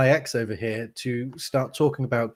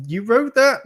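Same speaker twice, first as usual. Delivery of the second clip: high, loud incredulous question; mock disbelief; 12 semitones higher, 7 dB louder than usual. Host voicing doubtful listeners, mock disbelief. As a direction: act out the shocked audience.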